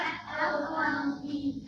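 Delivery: slow, spaced-out spelling of "F O L D"; letter by letter. Shown as a demonstration. Teacher spelling the second syllable aloud for the class, slow. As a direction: drop each letter slowly into place. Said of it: "Spell it".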